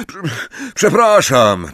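stammered apology. A narrator's reading. Ron repeating himself to Angelina, stammering an apology. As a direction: break off after the first syllable, then restart. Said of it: "powtórzył Ron".

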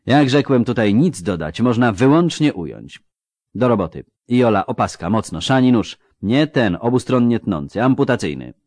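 brisk, brightly voiced halfling surgeon; lightly voiced doctor, dry and matter-of-fact; surgeon Rasty. Halfling surgeon assessing a wounded man, dryly brisk.

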